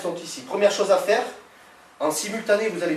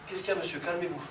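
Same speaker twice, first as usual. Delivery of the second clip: composed, soft-spoken de-escalation; calm reaction; 7 dB quieter than usual. Shown as a demonstration. Instructor models talking down an aggressor, calm and soft. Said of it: "Je me calme, je me tourne".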